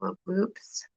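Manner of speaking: whispered aside; whispering. Real, not acted. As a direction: whisper it to yourself. Whispered aside while crafting.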